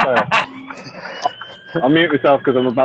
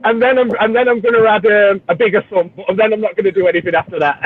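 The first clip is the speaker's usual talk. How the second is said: high-pitched, giggly teasing imitation; laughing mimicry; 8 semitones higher than usual. Teasing a friend about adding a bigger sump, laughing mimicry. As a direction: pile on the mock promises, barely holding back laughter.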